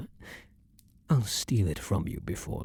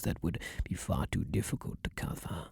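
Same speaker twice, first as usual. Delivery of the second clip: breathy hush, barely voiced; whisper; 4 semitones lower, 9 dB quieter than usual. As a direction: let the words fall into a whisper. Vampire soothing lover before bite.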